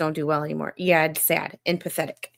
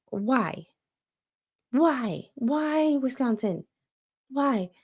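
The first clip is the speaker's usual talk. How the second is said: higher, drawn out, pleading why; exasperated why; 6 semitones higher, much slower than usual. Real, not acted. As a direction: beg the heavens for an answer. Exasperated pleading about team.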